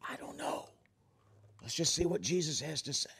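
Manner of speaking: hushed unvoiced whisper among the disciples. Nervous disciples whisper to each other at breakfast, hushed. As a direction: whisper it so Jesus won't hear. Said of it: "were whispering".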